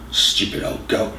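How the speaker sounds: soft unvoiced inner grumble; muttered thought. A narrator's reading. Jake insulting the old man in his head, whispered. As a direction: hiss it under the breath like a private insult. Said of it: "Jake thought".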